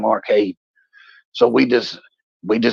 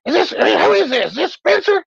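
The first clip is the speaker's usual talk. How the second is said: put-on much higher, confused girlfriend voice; startled girlfriend. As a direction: play the baffled girlfriend, pitched way up. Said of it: "She's like".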